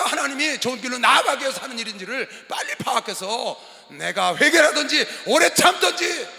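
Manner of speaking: loud, high pulpit exhortation; forceful preaching. Acted. Pastor urging self-examination in hardship, forceful preaching. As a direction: burst out high, then press on urgently.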